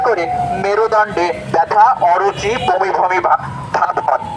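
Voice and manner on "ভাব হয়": ordinary talk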